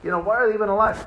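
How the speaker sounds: loud, high mock indignation; mock outrage. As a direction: rail at the screen in mock fury. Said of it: "They think".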